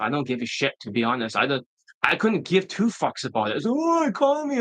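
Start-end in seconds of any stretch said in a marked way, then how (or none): 3.7-4.6 high falsetto mock whine; mock complainer. Students blaming the economy, high mocking whine. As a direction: whine it in a squeaky put-on voice.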